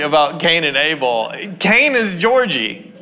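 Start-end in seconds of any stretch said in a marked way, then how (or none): none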